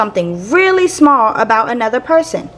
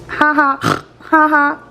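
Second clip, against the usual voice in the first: funny noises; 4 semitones higher, slower than usual. Retold or imitated. Grandpa's funny noises imitated.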